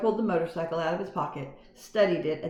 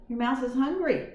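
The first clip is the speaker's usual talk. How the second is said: kind aunt; 7 semitones higher than usual. A narrator's reading. Aunt Jill voiced.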